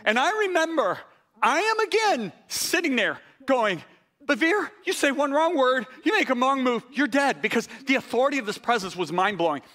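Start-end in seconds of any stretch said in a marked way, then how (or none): 4.3-7.4 retold tense warning, pitched up; urgent self-warning. Preacher recalls warning himself under an awesome presence, urgent self-warning. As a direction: warn yourself urgently, higher.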